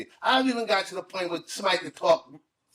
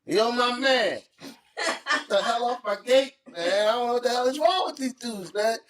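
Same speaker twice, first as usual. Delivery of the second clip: higher, slow, blunt demand; rough confrontation; 7 semitones higher, slower than usual. Retold or imitated. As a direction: tell him off bluntly. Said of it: "Why you gotta say it like that?".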